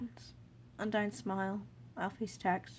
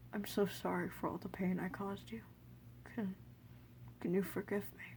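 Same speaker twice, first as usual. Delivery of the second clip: soft apology; 6 dB quieter than usual. Acted. King apologizing softly.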